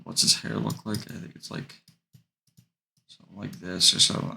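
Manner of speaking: ordinary talk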